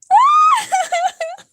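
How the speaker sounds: very high, loud shriek of laughter. Real, not acted. Friend cracking up at her own confusion, shrieking laugh. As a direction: burst out in a squealing laugh.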